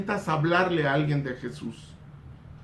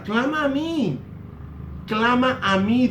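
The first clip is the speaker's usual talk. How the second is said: preached plea; 5 semitones higher than usual. Retold or imitated. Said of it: "dice la Escritura".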